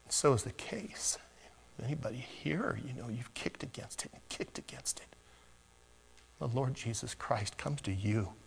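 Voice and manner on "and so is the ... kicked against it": breathy hushed whisper to the congregation. Real, not acted. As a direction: lean in and whisper it. Preacher drops to whisper.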